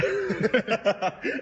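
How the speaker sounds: laughing. Real, not acted. Laughing at the video.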